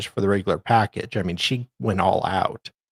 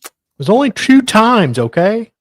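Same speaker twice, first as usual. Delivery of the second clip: much higher and louder, flustered; loud high-pitched objection to a teasing co-host; defensive protest; 11 semitones higher, 7 dB louder than usual. Real, not acted. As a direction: push back, caught out and embarrassed. Embarrassed protest over shared secret.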